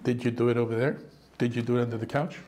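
low, soft, nagging wife checking his work; mock wife inspecting the vacuuming, low and soft. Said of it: "When she's watching".

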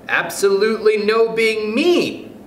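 put-on high, slow, drawn-out child voice; child's suggestion. A narrator's reading. Mildred suggests a law to the chairman, high and deliberate.

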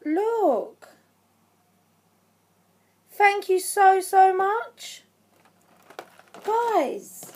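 high-pitched, disbelieving gratitude; overwhelmed, near tears. Real, not acted. Vlogger showing her friend's gift, overwhelmed. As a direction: squeal your thanks, barely believing it. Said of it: "I'm gonna cry".

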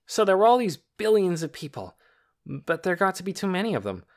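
put-on boy lecturing Lena with mild self-importance; important tone. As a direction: puff yourself up and hold forth. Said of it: "The important tone came back into his voice".

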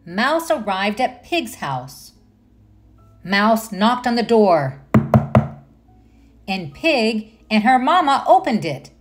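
ordinary delivery

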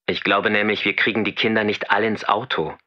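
normal talking voice

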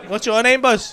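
ordinary talk, nothing marked

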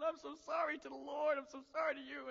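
high, wailing mock sobs; imitated tearful breakdown. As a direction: imitate a gruff man breaking down in tears. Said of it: "he just breaks down".

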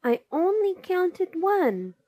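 high-pitched whine; plaintive little protest in a high voice; whining. A narrator's reading. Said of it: "Snitch whined".

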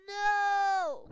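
long, loud, high no; dismayed yell. Real, not acted. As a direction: shout a drawn-out no. Cries out at game setback.

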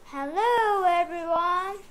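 mother duck calls to the farm animals; calling out. A narrator's reading. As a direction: hail the whole farmyard. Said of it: "she calls".

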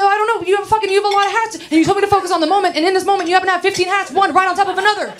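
high, rapid-fire defensive rant; flustered arguing. Acted. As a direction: argue back in a frantic high rush. Flustered argument about the hats.

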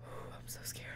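breathy frightened whisper after a sigh. Real, not acted. Scared player whispers to herself before moving on. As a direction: whisper it to yourself, afraid.

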